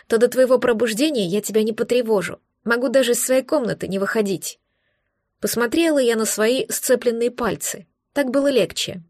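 usual speaking voice

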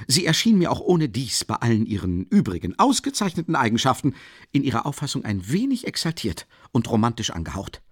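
plain reading voice

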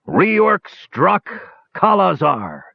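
high, ringing battle invocation; invocation cried out. A narrator's reading. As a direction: cry it out like a summons. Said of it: "he cried out".

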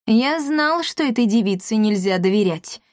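ordinary delivery, nothing marked

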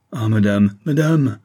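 loud exclamation, rising on the repeat; anguished cry. A narrator's reading. Paul pleads with the lady, crying out in distress. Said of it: "s'écria Paul".

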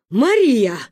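loud grandmother's wail of the name; anxious, loud lament. A narrator's reading. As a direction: call the name out in distress. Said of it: "запричитала Ба".

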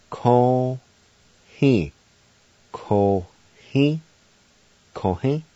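Ko, he said in two separate, open beats; Cherokee syllables. Shown as a demonstration. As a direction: koh then heh, each syllable clear. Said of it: "And now pronounce these words".

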